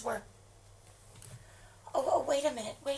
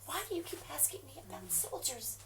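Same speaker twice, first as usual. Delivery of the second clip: hushed suspicious whisper; fearful whisper; 13 dB quieter than usual. Acted. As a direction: whisper so nobody hears. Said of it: "Why are you whispering?".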